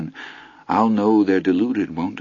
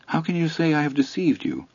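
higher character voice; 8 semitones higher, 4 dB quieter than usual. A narrator's reading. Miss Stansfield calmly puzzled.

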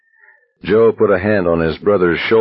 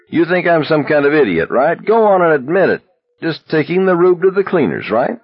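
angry ranting; 11 semitones higher than usual. A narrator's reading. Abraham keeps hollering at Baker.

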